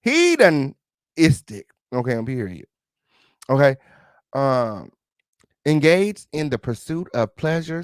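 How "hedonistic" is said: "Hedonistic" slow and high, starting with HEE; "Hedonistic" with a long first e, exaggerated; corrected pronunciation. Shown as a demonstration. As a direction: draw out "Hedonistic" as HEE-dun ... istic, loudly. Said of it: "letting me pronounce them wrong".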